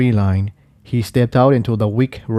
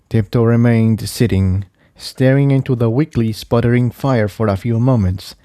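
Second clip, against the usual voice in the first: ordinary delivery, nothing marked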